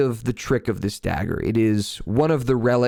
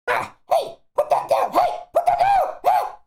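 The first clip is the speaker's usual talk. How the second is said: very high, squeaky goblin voice yelling. Acted. Kitchen goblin yelling at another goblin, high and squeaky. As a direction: shriek like a tiny goblin scolding.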